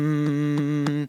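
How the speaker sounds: a soft chest hum. Shown as a demonstration. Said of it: "Humming in the chest".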